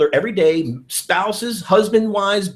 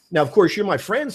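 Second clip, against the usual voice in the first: ordinary delivery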